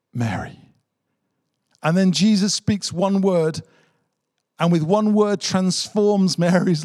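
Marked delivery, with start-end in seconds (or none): 0.1-0.7 1 tender calling. Acted. Jesus speaking Mary's name.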